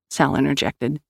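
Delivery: plain reading voice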